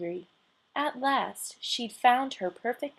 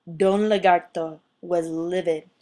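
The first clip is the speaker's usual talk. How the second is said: ordinary delivery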